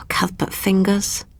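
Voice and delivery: slow, whispered sigh of exasperation; queen sighing over broken glass. Acted. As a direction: breathe it out, weary of his clumsiness. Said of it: "sighed the queen".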